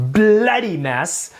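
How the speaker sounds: put-on a slow, lightly done British accent on "Bloody mess". Host trying a British accent for the co-host, slow and hammy. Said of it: "shall we throw a British accent in there".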